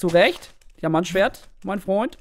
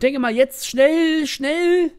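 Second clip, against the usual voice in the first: rushed excitement; 8 semitones higher, 5 dB louder, slower than usual. Real, not acted.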